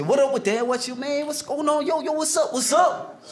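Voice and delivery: a hint of a New York street voice; New York street talk. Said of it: "sound like this here".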